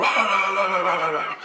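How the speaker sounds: high gibberish rant, "rah rah" run together; mock yelling. Man yelling at everybody, mock yelling. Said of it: "he's yelling about something".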